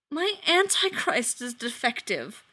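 a lightly voiced sulky child, slow; mild childish misery; small miserable child voice. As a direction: whine it like a kid who broke his new toy. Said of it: "in the small, miserable voice of a child".

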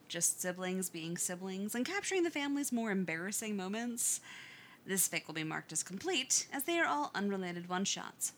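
ordinary delivery, nothing marked

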